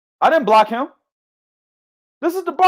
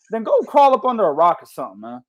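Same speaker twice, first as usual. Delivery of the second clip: normal talking voice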